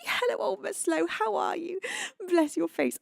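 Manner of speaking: sing-song high hello; bright high welcome; high-pitched greeting. Real, not acted. Greeting a viewer.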